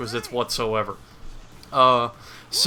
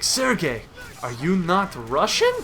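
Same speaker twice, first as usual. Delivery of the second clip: higher, questioning mock Russian accent; 4 semitones higher than usual. Rescued Russian questioning the hero, in a mock Russian accent. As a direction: play a puzzled Russian with a light accent. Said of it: "turns out to be a Russian".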